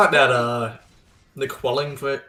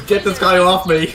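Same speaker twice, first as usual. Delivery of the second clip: laughing; 6 semitones higher, 11 dB louder than usual. Pretend. Co-caster laughing, voicing a player.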